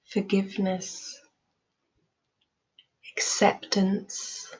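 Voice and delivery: calm, measured meditation voice with long pauses; guided meditation. Real, not acted. Teacher leading listeners through loving kindness meditation, calm guided delivery. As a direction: guide them gently, leaving space after each phrase.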